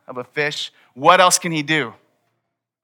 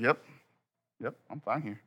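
feigned nonchalance; 3 semitones lower, 12 dB quieter than usual. Retold or imitated. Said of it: "like, he's in control".

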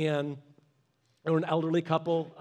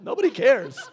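louder, higher protest; mock protest; 4 semitones higher, 7 dB louder than usual. Pastor tells the old man nobody cares, protesting. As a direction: brush it off with a lively laugh in the voice. Retelling chat with elderly man.

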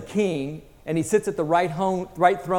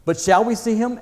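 higher, louder eager asking; eager question; 6 semitones higher, 5 dB louder than usual. A narrator's reading. Susan asking whether they will see Aslan, eager question.

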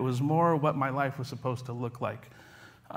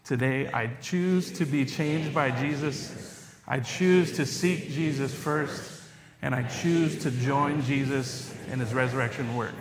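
slow, measured group affirmation; recited creed; slower than usual. Real, not acted. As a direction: recite it together, steady and solemn. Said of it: "if you guys will follow with me".

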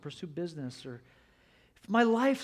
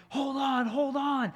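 higher, louder cry of hold on; desperate shout; 11 semitones higher, 9 dB louder, slower than usual. Retold or imitated. Indiana shouting to the slipping woman, desperate shout. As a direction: yell it while hanging on to her hand. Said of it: "he's kinda like".

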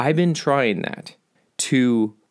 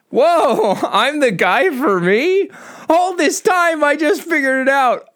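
excited realization; 12 semitones higher than usual. Real, not acted. Podcaster realizing he's the guy for him, high excited squeal. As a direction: squeal at the sudden revelation. Sudden self-discovery, laughing.

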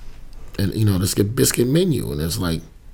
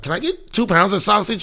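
higher, breezy entitled customer; a line-cutting lady, voiced higher and carefree; mock entitled lady; 10 semitones higher than usual. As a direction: airily cut the line. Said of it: "she's like".

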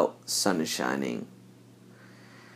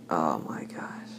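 slow breathy whisper; 5 semitones lower, 11 dB quieter, slower than usual. Real, not acted. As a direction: whisper it in disbelief. Exasperated aside.

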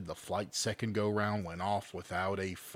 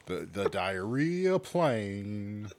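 slow, savoring diarrhea plane; drawn-out relish; much slower than usual. Acted. Savoring the silly phrase.

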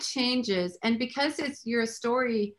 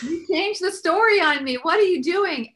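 higher and louder, an exasperated protest; exasperated complaint; 8 semitones higher, 6 dB louder than usual. Retold or imitated. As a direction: throw the question up at God in disbelief. Retold complaint to God.

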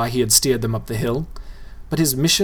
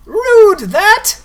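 much higher, loud ghoul shriek; shouting ghoul; 9 dB louder than usual. A narrator's reading. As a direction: shout it after them.

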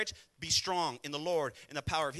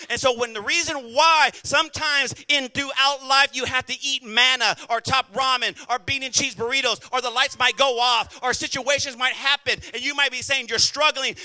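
loud, driving sermon delivery, pitched up; full-force preaching; 4 semitones higher, 9 dB louder than usual. Real, not acted. Preacher urging congregation to trust God's power, full-force preaching. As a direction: preach it hard, building in volume.

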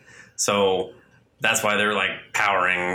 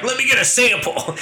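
mock Batman; 13 semitones higher, 4 dB louder than usual. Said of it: "Batman's like".

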